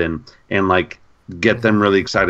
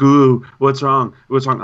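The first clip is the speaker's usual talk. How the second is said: slow, sing-song teasing, louder; mocking taunt; 4 semitones higher, 4 dB louder, slower than usual. Friend in the open elevator teasing a shaking Rob. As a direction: needle a scared friend playfully. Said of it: "was just laughing at me, like".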